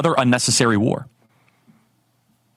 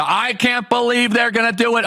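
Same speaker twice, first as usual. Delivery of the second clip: mock shocked pundit; 7 semitones higher than usual. Mocking the stunned DC reaction.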